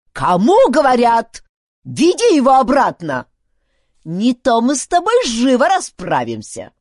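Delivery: high, loud barked orders; squawking, menacing insistence; angry threat. A narrator's reading. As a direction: demand obedience as an irate old chief. Chief threatens Duncan in quarrel.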